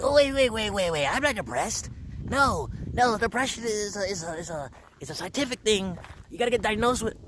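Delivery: high, squeaky panicked denial; panicked inner voice. Retold or imitated. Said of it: "in my mind, I was like".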